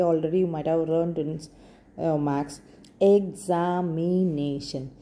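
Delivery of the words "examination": "Examination" stretched into five separate beats; slow syllables. Shown as a demonstration. Teacher giving a five-syllable example, "Examination" said slowly syllable by syllable. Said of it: "When you say slowly".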